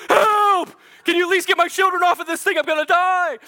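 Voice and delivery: high, loud, rushed mock screaming for help; hammed-up panic. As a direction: shriek it like you are going under. Imagined cry for rescue.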